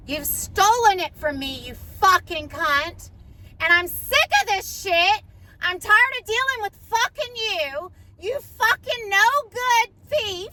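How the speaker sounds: high, loud screaming rage, slow on the insults; retold screaming fit; furious retold shouting. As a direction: scream it at the thief who took your house.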